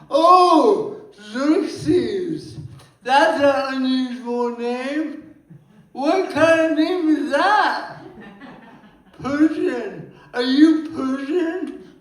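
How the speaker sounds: high, loud, exaggerated curiosity; acted surprised stranger. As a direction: play a nosy stranger amazed by the name. Acting stranger reacting to his name.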